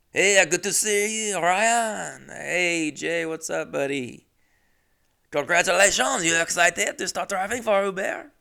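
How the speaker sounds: high-pitched, hammed-up French greeting; French accent. French boss greeting his new driver, exaggerated French accent. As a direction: gush like a caricatured Frenchman. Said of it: "Just a normal French guy".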